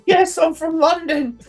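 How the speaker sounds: hysterical rock star. Retold or imitated. Cohost imitating Aldous answering while bleeding, hysterical high. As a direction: screech it like a man losing blood. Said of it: "He's becoming hysterical".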